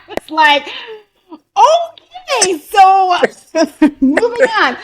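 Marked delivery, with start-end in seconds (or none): 1.6-4.8 very high, drawn-out okay through laughter; awkward mock retreat. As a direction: squeak it out laughing, then slowly move on. Mock-retreat after being told no.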